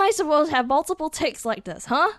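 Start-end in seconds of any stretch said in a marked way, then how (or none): none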